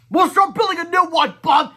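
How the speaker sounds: very high, loud order; high shouted command; shouting. Acted. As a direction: bark a command. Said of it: "shouted Crappy the Kid".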